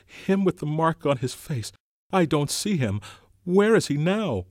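urgent, worried asking; Piggy alarmed. A narrator's reading.

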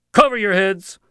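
high, very loud shouted order; yelled command. A narrator's reading. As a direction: yell it out to the whole camp. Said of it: "yelled the head of the camp".

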